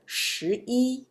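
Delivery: 一 in 十一 held high and level; first-tone 一. Shown as a demonstration. Teacher gives counting example, 一 in first tone. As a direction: end 十一 on a high, steady 一. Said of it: "the pronunciation is first tone".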